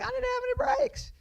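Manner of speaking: squeaky, panicked high-pitched whine, quick; whiny high squeak. Retold or imitated. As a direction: play yourself as a helpless kid squealing an excuse. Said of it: "And I'm like".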